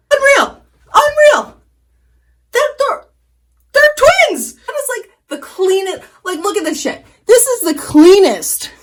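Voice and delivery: very high-pitched, overjoyed disbelief; amazed squealing. Real, not acted. Vlogger seeing her eyeliner wings are twins, squealing in amazement.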